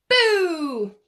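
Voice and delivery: loud high boo; shouted boo. Acted. Cub scaring the killer whales underwater, loud boo. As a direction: burst out with it.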